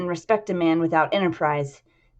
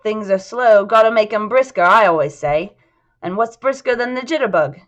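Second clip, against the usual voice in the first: louder, livelier character voice; chirpy flirting Bill; 4 semitones higher, 6 dB louder than usual. Acted. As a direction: play a man pitching a night out, punchy and loud.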